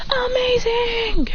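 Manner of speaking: high, drawn-out cry of amazement; mock shout. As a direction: stretch it out like two awed kids. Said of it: "then they both shout".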